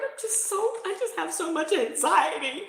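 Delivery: high, small worried voice; imitating anxious friend. Friend explaining her anxiety, mimicked in a high small voice. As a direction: play the nervous friend, thin and timid.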